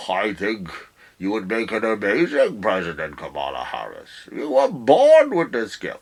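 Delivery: hint of a gushing host; mock Howard Stern. Mock Stern praising Harris.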